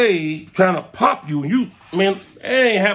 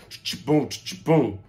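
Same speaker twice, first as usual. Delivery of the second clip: imitated shotgun; 2 semitones lower, 4 dB quieter than usual.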